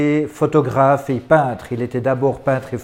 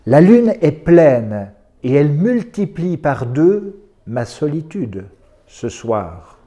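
poetry recital; slower than usual. Acted. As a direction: recite each poem with long, suspended pauses. Lit des poèmes à voix haute. (lecture de poésie lente et posée)

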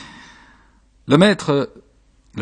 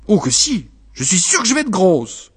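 higher, insistent wife; raised-pitch marital protest; insistent contradiction; 6 semitones higher than usual. Acted. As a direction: push back, certain of it. Wife contradicts her husband.

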